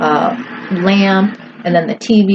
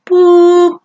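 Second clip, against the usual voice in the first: loud, high held "boop"; sustained high tone made by voice; imitated ringing tone; 11 semitones higher, 6 dB louder than usual. Shown as a demonstration. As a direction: mimic an ear-ringing pitch on one steady note. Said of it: "your conscious brain will hear that".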